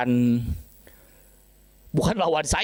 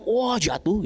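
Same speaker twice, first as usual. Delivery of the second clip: mild mock cry while falling; half-hearted high-pitched yell; retold yell; 5 semitones higher, much slower than usual. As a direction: imitate a falling devil's yell, pitched higher. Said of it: "tiba-tiba dia teriak".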